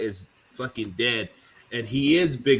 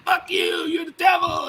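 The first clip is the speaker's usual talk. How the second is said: hammed-up yell, much higher; retold screaming. Family yelling at Kobe on TV, mock furious shout. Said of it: "screaming at Kobe".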